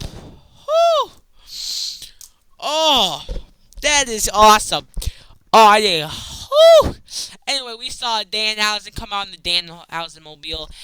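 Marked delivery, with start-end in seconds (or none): 0.0-1.1 squeaky high exhale; high whistle-like whew. Real, not acted. Exhale reaction. 6.1-6.9 squeaky high exhale; high whew. Real, not acted. Exhale reaction.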